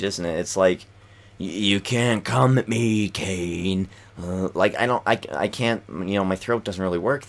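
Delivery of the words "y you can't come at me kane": slow, odd imitation of a wrestler taunting his rival; a lightly voiced wrestler taunting Kane, slowly; Dean Ambrose voice. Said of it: "his voice is very strange".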